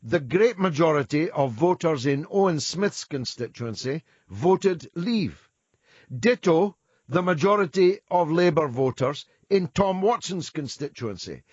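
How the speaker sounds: loud, forceful listing; emphatic. Real, not acted. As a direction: hammer each point home. Host heatedly listing constituencies.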